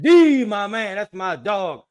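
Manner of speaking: excited shout-out; hyped greeting. Real, not acted. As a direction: greet him like a buddy.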